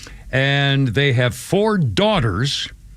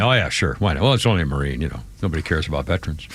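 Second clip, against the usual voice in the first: low, offhand mock agreement; sarcastic; 9 semitones lower, 4 dB quieter, faster than usual. Co-host answering about a Marine left behind, sarcastic. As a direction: toss it off low, dripping with irony.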